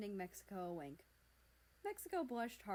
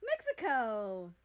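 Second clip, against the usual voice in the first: loud, drawn-out joyful call of a name; happy cheer; 2 semitones higher, 11 dB louder, slower than usual. A narrator's reading. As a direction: cheer his name with delight. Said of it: "Canada cheered happily".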